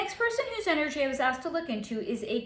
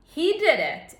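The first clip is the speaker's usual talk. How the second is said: usual speaking voice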